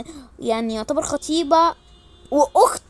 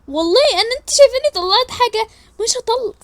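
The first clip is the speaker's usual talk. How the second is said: high protest; 9 semitones higher, 5 dB louder than usual. Acted. Protesting innocence.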